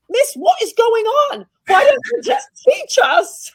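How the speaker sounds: a pupil fed up, demanding a proper lesson; a boy shouting at his teacher in the inspected lesson, fed up and loud; annoyed schoolboy. Retold or imitated. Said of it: "And he was so annoyed".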